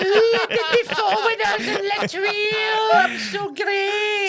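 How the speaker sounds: high, slow laughing mock brag; mocking boast. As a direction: imitate a smug friend, slowly.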